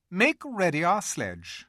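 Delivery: high, imperious order; commanding. A narrator's reading. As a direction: order the dwarf coldly. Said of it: "ordered the witch".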